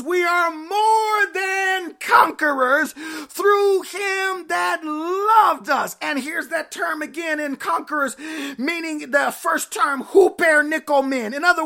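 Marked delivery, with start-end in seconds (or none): 0.0-6.0 slow climax. Acted. Climax of the sermon reading.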